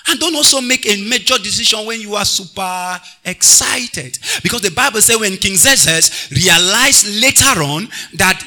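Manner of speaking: loud, high, drawn-out preaching; preaching at full force. Acted. Pastor preaching to the choir about anger, at full force. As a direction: preach it over the music to the church.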